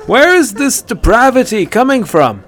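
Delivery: high, loud half-laughing question; laughing hunter; amused incredulity. A narrator's reading. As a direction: grin through disbelief.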